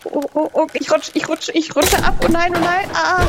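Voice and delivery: high, loud alarmed cries; panicked slipping. Acted. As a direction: yelp as you lose grip. Character slipping off ladder.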